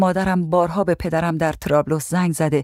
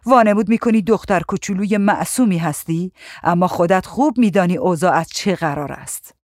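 ordinary delivery

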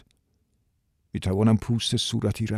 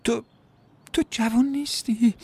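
tearful woman; 12 semitones higher than usual. Acted. Diane weeps over how long Danny took, high and tearful. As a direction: reproach through tears. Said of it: "اشک از چشمانش سرازیر شد".

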